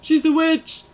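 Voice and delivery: high, loud accusing cry; accusing villager. Pretend. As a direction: shout it like a mob villager. Mock witch-hunt accusation.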